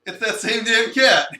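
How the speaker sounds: loud, high exasperated outburst. Acted. Complaining about the cat to friends, loud exasperated. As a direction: blow up about that damn cat.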